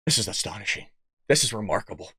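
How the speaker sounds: very low, hushed monotone; stunned understatement, low and quiet; deadpan astonishment. Real, not acted. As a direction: drop to a deep, soft deadpan. Mock-grave awe at the promotion.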